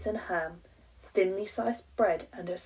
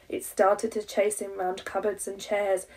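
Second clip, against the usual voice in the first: ordinary delivery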